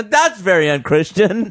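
high, loud amused scolding; laughing reproach. Real, not acted. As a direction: tease them through a laugh. Laughing reproach after church joke.

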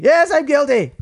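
high, bright-eyed admission of guilt; mock eager confession. Cheerful high confession to the court. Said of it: "They're like".

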